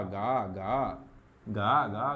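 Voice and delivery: very slow, stretched गा गा; drawn out गा. Shown as a demonstration. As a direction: drag out each गा to drill it in. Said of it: "अगर कहीं गा मिल गया". (गा को खींचकर बोलो)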